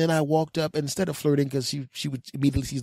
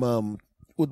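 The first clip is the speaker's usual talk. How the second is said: ordinary talk, nothing marked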